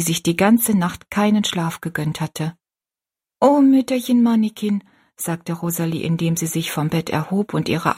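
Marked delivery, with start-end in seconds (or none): 3.4-4.8 higher and louder, full of thanks; girl hugging the kind old woman; grateful outburst. A narrator's reading. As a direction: throw the name out with a hug. Rosalie embraces grateful.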